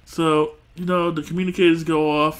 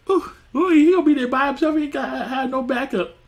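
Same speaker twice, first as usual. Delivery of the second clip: much higher excited teasing ooh; gleeful tease; 10 semitones higher than usual. Real, not acted. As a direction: tease him with glee. Laughing tease about Justin.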